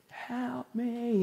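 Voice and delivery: high, faint plea; imitated distant scream. Preacher imitating a woman on a boat, faint high cry. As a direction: plead high and softly, drawn out as if far away. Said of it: "I just heard this screaming".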